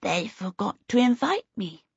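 put-on small, lisping boy voice; sweet little voice. A narrator's reading. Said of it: "Twinkle's sweet little voice replied".

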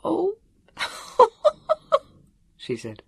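high-pitched laughing fit; laughing till the tears run; helpless laughter. A narrator's reading. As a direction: gasp it out through helpless giggles. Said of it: "the laugh came out".

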